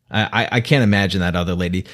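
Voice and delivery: ordinary talk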